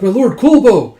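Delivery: loud, high call to a lord; retold shout of alarm; acted alarmed cry. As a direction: cry out in dismay.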